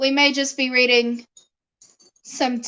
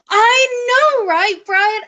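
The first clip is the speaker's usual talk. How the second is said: high, gushing excitement; excited agreement; 7 semitones higher, 4 dB louder, slower than usual. Real, not acted. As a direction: agree eagerly, voice soaring.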